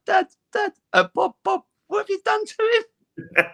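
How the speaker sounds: high, wailing mock cry of a shocked mum; imitating his tearful mum at the airport, high and wailing. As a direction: wail it like a mother in tears at the sight of her son. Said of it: "she started crying and was like".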